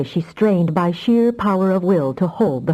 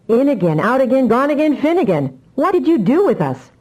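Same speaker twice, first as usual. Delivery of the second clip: agitated; 8 semitones higher, 3 dB louder than usual. A narrator's reading. Shaken captain over comlink.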